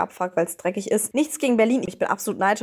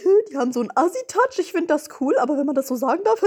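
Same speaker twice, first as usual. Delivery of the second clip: put-on girly quote voice; 6 semitones higher, faster than usual. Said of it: "Romina sagt gleich".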